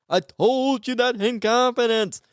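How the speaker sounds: high, drawn-out mock complaint; slow, exaggerated high-voiced objection; mock protest. Acted protest at betrayed secret.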